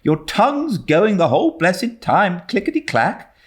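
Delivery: a hint of a scolding guardian; mildly put-on older woman, chiding; lightly voiced stern Marilla. A narrator's reading.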